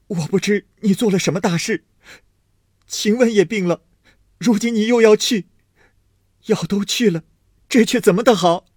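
high, loud lament holding back tears; a young master grieving, voice full of tears; tearful lament. A narrator's reading. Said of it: "含泪说道". (含泪高声哀叹)

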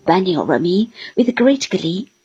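usual speaking voice

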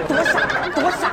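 put-on high, laughing scoff at a silly kid; mock yourself through laughter, pitched high; laughing mockery. Mocking own childish self. (边笑边自嘲)

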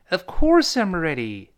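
slow phrase, "course" high and long after light "Of"; stress on course. Shown as a demonstration. Teacher repeats for learners, slowly stressing "course". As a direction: lean hard on "course", glide through the rest. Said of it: "而过，course重读".

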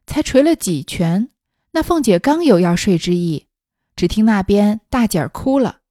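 measured, projected storybook reading; story narration. A narrator's reading. Narrator reading a classic novel to listeners, story narration. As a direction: read it out deliberately, acting the dialogue lightly.

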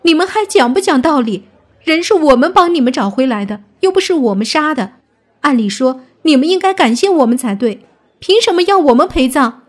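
high, loud indignant rebuttal; heated retort. Acted. Companion arguing with the villagers, indignant and loud. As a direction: argue back hard against the accusation. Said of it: "方千灵反驳道".

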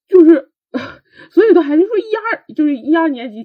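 a choked laugh breaking into crying; tearful laugh. Real, not acted. Remembering the chef's words, laughing through real tears.

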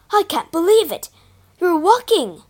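high, loud amazed cry; astonished. Acted. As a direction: burst out in disbelief. Father astonished at daughter walking.

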